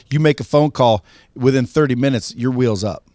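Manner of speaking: ordinary talk, nothing marked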